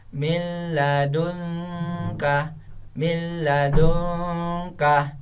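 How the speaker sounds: "min ladunka" run into a long doubled L, no nasal hum, slowly drawn out; correct idgham bila ghunnah. Shown as a demonstration. Teacher showing children the reading, "min ladunka" slow with doubled L. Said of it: "seperti itu cara bacanya".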